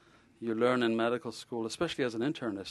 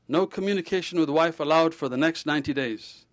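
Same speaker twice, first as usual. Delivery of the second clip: louder, emphatic repeat of the rule; firm repeat; 5 semitones higher, 6 dB louder than usual. Retold or imitated. Ghosh turning to the patient and repeating it, firmer and louder. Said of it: "and he repeated".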